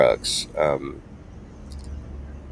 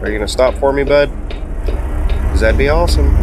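sarcastic call-out; 7 semitones higher, 3 dB louder, faster than usual. Real, not acted. Calling to a car in the road.

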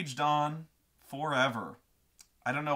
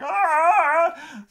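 long, high, mock-furious scream; hammed-up roar of fury; enraged scream; 14 semitones higher, 12 dB louder than usual. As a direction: scream wordlessly in imitated rage. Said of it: "going into the Red Rage".

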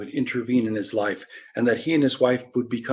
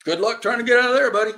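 higher, faster, louder taunt; mock send-off; 10 semitones higher, 5 dB louder, faster than usual. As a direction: call it out high and quick. Acted taunting call to someone.

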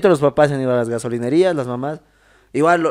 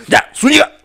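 mock presidential order; 2 semitones higher, 5 dB louder than usual. Arce confronting Zúñiga face to face, mock stern order. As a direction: call the general out, hammed up. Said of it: "estaba cara a cara con el Arce".

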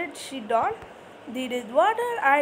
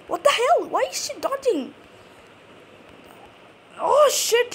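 raging gamer; 9 semitones higher than usual. Real, not acted. Gamer losing a fight.

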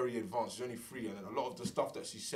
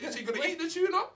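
put-on high, loud little-girl voice with a funny accent; imitating toddler daughter; 6 dB louder than usual. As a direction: mimic the toddler's odd way of talking. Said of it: "Does your daughter have an accent?".

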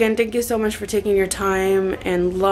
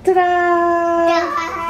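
excited reveal; 8 semitones higher, 3 dB louder than usual. Real, not acted. Reveal of vanity.

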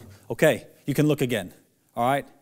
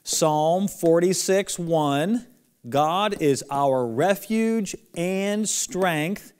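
slow and loud, every word drawn out; teacher calling out a memory verse for kids to repeat; slow lead-along recitation; 4 semitones higher, 3 dB louder, much slower than usual. Shown as a demonstration. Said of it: "Everybody again, following the marker".

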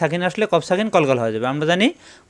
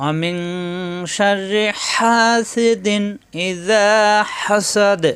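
very slow, higher melodic chant; Quran recitation; 3 semitones higher, much slower than usual. Acted. Teacher reciting the verse to students, slow melodic chant. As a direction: draw each syllable out in tilawat.

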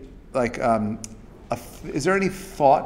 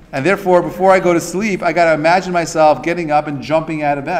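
higher and louder energized push; fired up; 4 semitones higher, 6 dB louder than usual. Real, not acted. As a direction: pump the room up. Excited pep talk.

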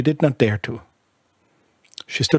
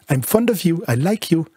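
put-on higher, tender baby talk; cooing; 6 semitones higher than usual. Bertha telling her baby she likes her, cooing higher. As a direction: croon it to the baby.

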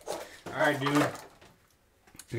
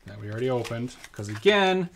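ordinary delivery

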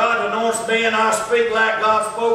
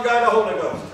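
usual speaking voice

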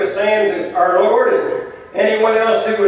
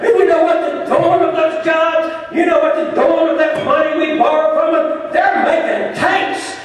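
fiery rally preacher, shouting the refrain; 7 semitones higher than usual. Acted. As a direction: whip up the crowd, call and response. Union rally, preaching at full force.

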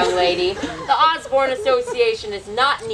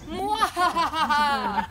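slow evil laugh; 4 semitones higher, much slower than usual. Acted.

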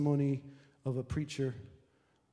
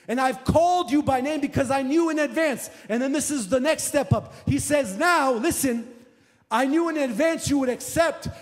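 loud, high preaching at a fever pitch; full-force preaching; 11 semitones higher, 9 dB louder than usual. Acted. Preacher driving his point home to the church, fiery and loud. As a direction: preach it to the back row.

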